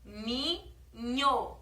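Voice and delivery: "Caminho" stretched very slowly syllable by syllable; spelled out slowly. Shown as a demonstration. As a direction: draw "Caminho" out slowly, ca-mi-nho. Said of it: "Três sílabas". ("Caminho" bem devagar, sílaba por sílaba)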